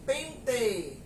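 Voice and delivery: Paraná-style "pente" with a full final "ê"; Paraná accent, clear closed E ending "pente". Retold or imitated. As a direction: imitate Paraná, saying pente as "pentê". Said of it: "pronunciam a palavra com som de E".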